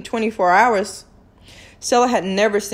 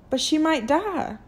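higher, softer plea near tears; softly pleading, voice raised in pitch; 7 semitones higher, 4 dB quieter than usual. A narrator's reading. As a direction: plead while holding back tears. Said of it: "she pleaded".

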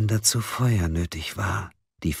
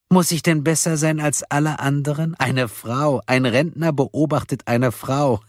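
higher, scornful caller voice; mocking caller; 7 semitones higher than usual. A narrator's reading. Caller ridicules the group's guesses about him, mocking. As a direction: ridicule them with a small laugh.

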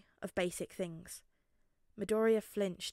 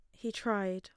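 usual speaking voice

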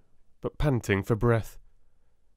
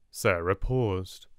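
plain reading voice

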